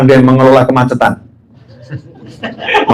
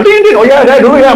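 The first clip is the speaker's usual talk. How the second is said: mock honking alert; 12 semitones higher, 3 dB louder than usual. Mimics horns as traffic starts moving.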